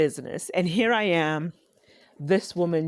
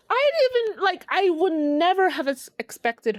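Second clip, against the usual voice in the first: high-pitched, astonished outburst; amazed disbelief; 12 semitones higher, 3 dB louder than usual. Real, not acted. Podcaster recalling an unexpected fan at work, amazed. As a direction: let the voice leap up in disbelief.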